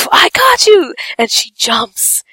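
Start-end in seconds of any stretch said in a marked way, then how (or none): 0.1-0.9 high, eager mock call; heroic catch cry. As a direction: call it out like a track star lunging. Sister lunging to catch brother.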